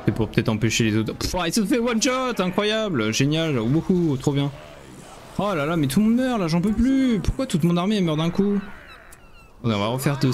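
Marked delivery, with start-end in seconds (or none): 1.1-4.5 high, mock-delighted praise; sarcastic cheer. Real, not acted. Sarcastic cheer at losing units. 5.4-8.6 high, exasperated wail; despairing outburst as troops fall. Real, not acted. Army dying, exasperated.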